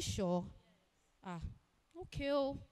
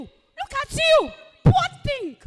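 contemptuous shout; 7 semitones higher, 11 dB louder, slower than usual. Acted. Woman mocking the waiter.

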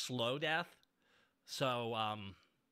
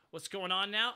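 ordinary delivery

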